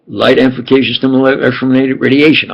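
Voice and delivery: rattled off fast; quick, run-together recital of jargon; rapid-fire. Real, not acted. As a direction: race through the technical term. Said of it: "I'll say it a little slower".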